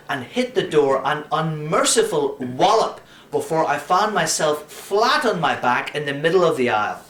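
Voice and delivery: plain reading voice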